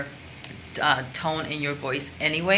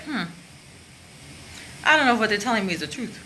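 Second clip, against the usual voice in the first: skeptical; 6 semitones higher, faster than usual. Shown as a demonstration. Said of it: "you're skeptical".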